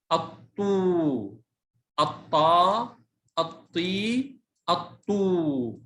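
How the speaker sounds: أطو، أطا، أطي each drawn out slowly; slow drill, long final oo, aa, ee in أطو، أطا، أطي; long vowels. Shown as a demonstration. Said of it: "صوت طويل مع ال".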